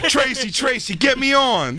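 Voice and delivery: high pleading beggar voice; begging newcomer. Retold or imitated. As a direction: plead like a desperate rookie.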